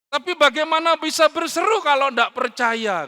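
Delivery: high, loud rhetorical challenge; forceful preaching. Acted. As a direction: fire the question out at the congregation. Preacher hammering a quoted scripture question.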